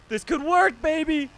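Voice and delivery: high, loud gleeful yell; excited yell at the game; hyped shout. Real, not acted. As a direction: crow it like the plan is clicking. Escape working in chase.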